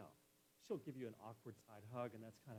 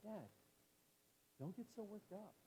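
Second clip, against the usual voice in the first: higher-pitched voice of a daughter chiding dad; imitated daughter's protest; 8 semitones higher than usual. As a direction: mimic a daughter telling dad off. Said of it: "the moment I don't use the proper tone, they're like".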